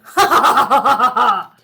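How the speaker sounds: loud, cackling villain laugh; wicked laugh. A narrator's reading. Varmint cackles loud and wicked at the old man. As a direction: cackle with glee at the trick. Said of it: "he laughed wickedly".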